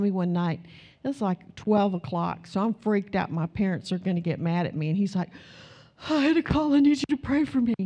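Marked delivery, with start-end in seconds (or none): 6.1-7.7 put-on higher, anxious young man on the phone; lightly voiced scared football player; boyfriend's scared call. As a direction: voice the frightened boyfriend. Boyfriend voiced calling late scared.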